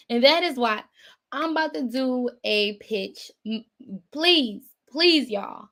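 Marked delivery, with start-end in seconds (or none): none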